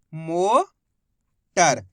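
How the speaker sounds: Motor stretched very slowly; motor said very slowly as a final reveal; drawn out word. Shown as a demonstration. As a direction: draw out motor, every sound long. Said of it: "मो का उच्चारण निकल रहा है".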